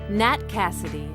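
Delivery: ordinary talk, nothing marked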